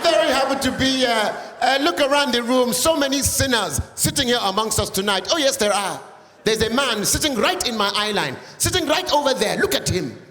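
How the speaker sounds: hammed-up preacher addressing sinners. Preacher scolding the sinners in the audience, mock sermon. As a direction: sermonize at the room like a revival preacher.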